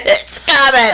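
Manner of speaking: mild imitation of a panicked cry; retold scream. Warning her son while driving, mild mock scream. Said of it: "And I'm screaming".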